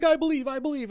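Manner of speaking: high fake desperate plea; mock pleading heroine. Host mocking the heroine forcing belief, high mock plea. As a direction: beg it in a silly high voice.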